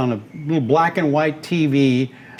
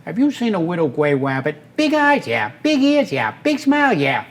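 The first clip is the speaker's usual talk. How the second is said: cartoon hunter voice; 3 semitones higher than usual.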